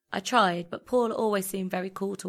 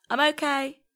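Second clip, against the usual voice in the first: high, louder call to a friend; calling back; 8 semitones higher, 6 dB louder than usual. A narrator's reading. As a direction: reassure a worried friend from a distance.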